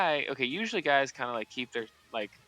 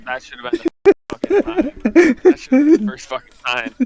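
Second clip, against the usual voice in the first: yelled outburst, much higher and louder; exasperated shout; 13 semitones higher, 15 dB louder than usual. Real, not acted. Telling friends about the patient, shouting in disbelief. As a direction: blurt it out in disbelief. Outraged at patient's eager request.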